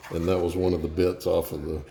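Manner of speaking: ordinary talk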